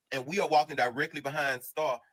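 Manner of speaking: normal talking voice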